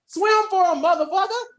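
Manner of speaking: high, loud mocking taunt; taunting villain. Elgar taunting the Rangers to swim, high and loud. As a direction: sneer it at the heroes.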